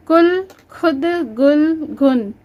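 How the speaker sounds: ordinary talk, nothing marked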